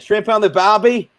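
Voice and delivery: high-pitched attempt at an Aussie accent. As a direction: try out an exaggerated Australian accent. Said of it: "do an Aussie accent".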